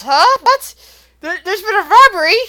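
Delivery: high, loud startled questions; loud, high shocked guard captain; surprised captain. Acted. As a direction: sound caught off guard. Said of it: "looks up and says".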